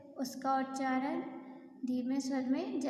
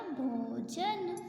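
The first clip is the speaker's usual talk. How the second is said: slow blended answer; much slower than usual. Shown as a demonstration. Teacher answering her own question for pupils, slowly. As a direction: draw out भोजन very slowly after the syllables. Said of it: "क्या हो जाएगा?".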